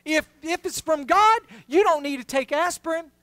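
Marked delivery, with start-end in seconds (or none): none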